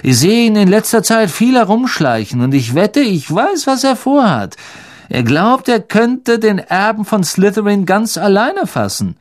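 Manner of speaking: high-pitched, animated character voice; scornful Malfoy. Acted. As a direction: gossip about him in a high voice. Malfoy gossips scornfully about Percy.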